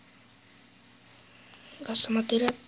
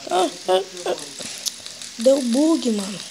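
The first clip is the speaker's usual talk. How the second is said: loud, frustrated shouting; raging gamer; 5 dB louder than usual. Real, not acted. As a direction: blow up at the game glitch.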